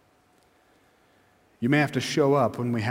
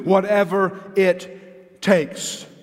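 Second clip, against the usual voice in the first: higher, drawn-out climax ending on "takes"; emphatic word by word; 5 semitones higher, much slower than usual. Real, not acted. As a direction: punch out "whatever", "it", "takes" separately. Rallying climax of repeated slogan.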